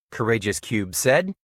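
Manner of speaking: ordinary delivery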